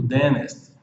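"dentist" said as "dennist"; silent T. Shown as a demonstration. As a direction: drop the middle T in "dentist". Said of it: "com T mudo". ("dentist" com o T do meio mudo)

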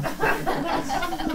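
quiet airy chuckle; soft breathy laugh. Real, not acted. Laughing at his own joke to the congregation.

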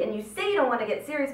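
ordinary talk, nothing marked

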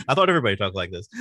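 laughed through, fast; giggling through a naive confession; laughing. Retold or imitated. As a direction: rattle it off while laughing. Laughing retelling of naive belief.

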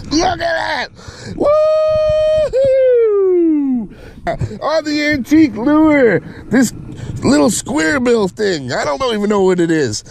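high, whooping excitement; real giddy amazement; thrilled. Real, not acted. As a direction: voice way up, crowing over the catch. Celebrating the antique lure catch.

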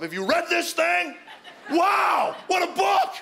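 very high, loud hollering; ecstatic shouting. Retold or imitated. New believer holding the Bible up on his lawn, ecstatic shouting. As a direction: holler it out, wildly overexcited.

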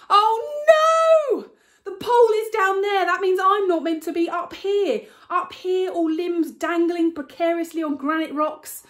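high, loud panicked outburst; panicked alarm. Acted. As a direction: blurt it out as the danger sinks in. Realising she's on wrong ridge.

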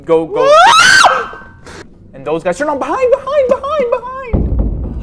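high, loud panicked warning; panicked shout. Real, not acted. Gamer panics as enemies wake behind. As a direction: yell the danger behind them.

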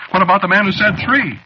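high, loud, urgent question; alarmed demand. Acted. Haunted man questioning the accident report, alarmed.

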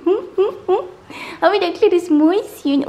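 put-on loud, sing-song baby talk. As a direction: coo at the puppy.

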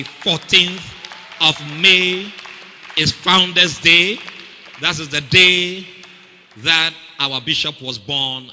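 slow, loud, emphatic announcement; loud preaching. Real, not acted. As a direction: proclaim the date to the church, slowly. Preacher announcing Founders' Day.

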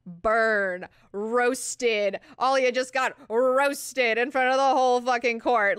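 loud, rising crowing over a burn; gleeful hype. Real, not acted. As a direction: announce the takedown like a rowdy onlooker. Host crowing over the insult.

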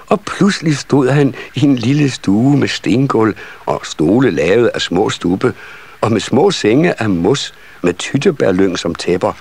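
ordinary delivery, nothing marked

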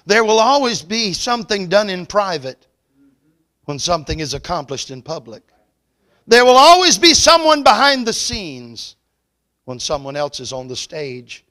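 rising preacher's cry; preaching. Acted. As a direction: preach it out, climbing to the peak. Preaching about hidden servants.

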